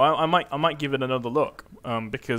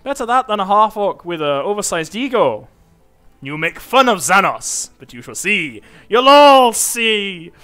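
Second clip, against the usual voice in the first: louder, taunting brute voice; boastful half-orc; 8 dB louder than usual. Acted. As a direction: puff up and mock the dwarf.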